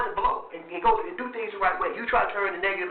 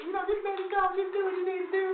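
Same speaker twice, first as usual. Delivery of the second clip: put-on high girly voice, up in falsetto; girl voice; 3 dB quieter than usual. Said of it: "He hit the little, the girl voice".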